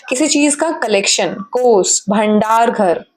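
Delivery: ordinary talk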